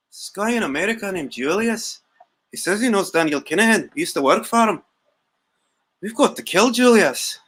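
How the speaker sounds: put-on swooping, lilting Irish accent; mock Irish accent. Irish agent reporting on this guy Julius, mock Irish accent. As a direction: mimic an Irishman's singsong lilt. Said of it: "That's why I'm mimicking it".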